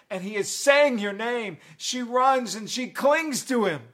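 loud, high, fervent sermon storytelling; full-force preaching. Real, not acted. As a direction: preach it at full voice, driving each phrase.

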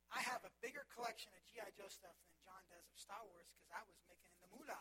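ordinary talk, nothing marked

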